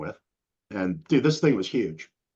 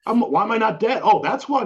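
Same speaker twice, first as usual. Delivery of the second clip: high, loud disbelieving yell; retelling the shock of the doctor's news in a loud, high yell; shocked outburst; 11 semitones higher, 6 dB louder than usual. Retold or imitated. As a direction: blurt it out in sheer disbelief. Hearing blood sugar eight hundred.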